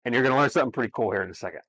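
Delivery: ordinary talk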